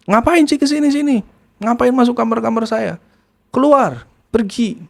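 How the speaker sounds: high, snapping mock-angry voice; angry nephew. Nephew ordering his aunt out of his room, mock-angry and high. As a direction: throw her out of the room.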